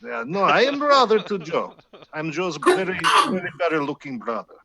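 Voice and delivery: cheerful mock Russian accent. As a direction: brag about being the brother in a Russian accent. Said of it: "You're Russian cheating".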